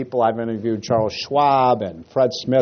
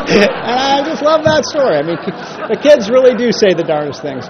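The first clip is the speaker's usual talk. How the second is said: laughing; 9 semitones higher, 5 dB louder than usual. Real, not acted. Laughing after the joke.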